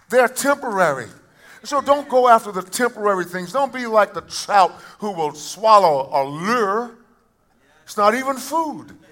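urgent sermon admonition; forceful preaching. Acted. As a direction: exhort them with rising pitch. Preaches a warning.

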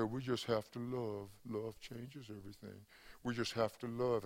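soft, slow Georgia drawl, lightly imitated; a gentle hint of John Lewis's southern drawl, slow and soft. Pastor quoting John Lewis, soft slow Georgia drawl. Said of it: "in his own, uh, Georgia drawl or Alabama drawl".

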